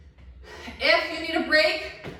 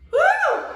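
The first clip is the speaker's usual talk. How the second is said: excited whoop; 6 dB louder than usual. Real, not acted. Cheering self on mid-workout.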